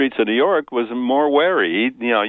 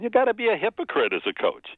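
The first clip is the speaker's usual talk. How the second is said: coach's voice; 7 semitones higher than usual. Retold or imitated. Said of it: "He said".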